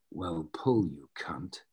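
low, gruff Scottish Connery growl, dropping to a mutter; Sean Connery impression. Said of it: "you can do the accent perfectly".

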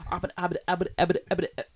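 high, loud stuttering cartoon pig; Porky Pig stutter. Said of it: "Show 'em what you got, Notorious P I G".

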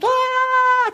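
high, loud squeal of joy; child's joyful scream. Retold or imitated. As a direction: scream like a thrilled three-year-old. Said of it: "A început... țipa".

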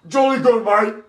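very high, loud British squawk; mock British accent. Prankster mocking British strangers, shrieked mock British accent. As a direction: shriek it as a cartoon Brit.

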